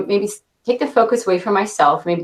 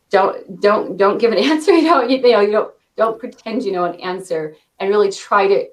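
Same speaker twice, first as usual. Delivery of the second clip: ordinary talk, nothing marked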